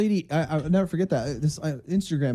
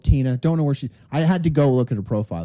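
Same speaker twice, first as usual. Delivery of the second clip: ordinary talk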